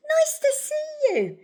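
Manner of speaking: very high, loud, drawn-out greeting; sing-out welcome shout. A narrator's reading. Narrator voices duckbills greeting Drip at the river, shouting. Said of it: "and they shouted".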